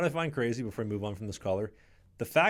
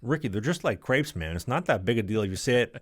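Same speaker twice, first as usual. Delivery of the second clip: normal talking voice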